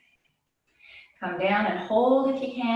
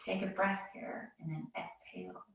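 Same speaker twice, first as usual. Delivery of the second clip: soft calming cue; 12 dB quieter than usual. Real, not acted. Calming cue during held pose.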